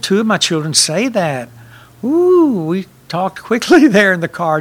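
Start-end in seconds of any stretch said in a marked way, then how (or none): 2.0-2.6 a high, startled ooh. Retold or imitated. Father remembering his children's words, high startled ooh. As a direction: yelp a high ooh of alarm. Recalling kids saying they want to die.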